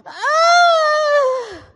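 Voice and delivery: high, loud scream of fright; terrified scream. Retold or imitated. Fleeing the dog, she screams in loud terror. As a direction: let out a panicked shriek. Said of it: "I heard myself go".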